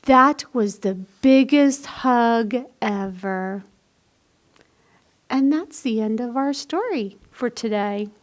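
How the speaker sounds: tender read-aloud ending; reading to children. A narrator's reading. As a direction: wind the story down sweetly for kids. Reads a picture book aloud.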